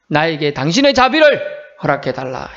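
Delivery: normal talking voice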